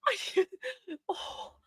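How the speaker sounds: too choked up to get words out; crying. Real, not acted. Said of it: "like, ugly crying".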